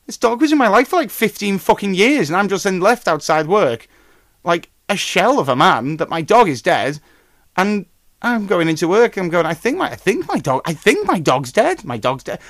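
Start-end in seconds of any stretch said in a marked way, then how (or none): 0.1-9.2 higher and louder, worked-up; exasperated rant. Real, not acted. Exasperated rant about the dog. 9.4-12.4 stunned disbelief. Retold or imitated. Retelling his shocked self.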